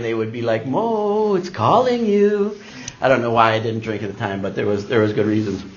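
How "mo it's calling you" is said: high, drawn-out singsong temptation; teasing. Retold or imitated. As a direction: imitate a coworker luring him to drink. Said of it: "They would tease me".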